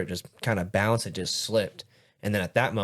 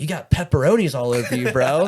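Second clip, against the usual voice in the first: teasing coworker; 4 semitones higher, 7 dB louder than usual. Retold or imitated.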